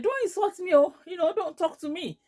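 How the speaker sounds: high-pitched angry protest; retold anger. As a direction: push back crossly, acting out the quarrel. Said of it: "I was getting angry".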